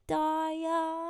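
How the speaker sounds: high, drawn-out call to dad; wheedling child. Retold or imitated. Child getting to the point with dad, wheedling. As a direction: imitate a kid softly pleading upward.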